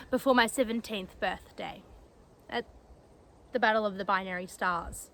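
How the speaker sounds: soft, low, halting recollection; hushed and heavy with grief; subdued grief. Acted. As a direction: recall the loss quietly, with long pauses. Recalls death of someone who cared.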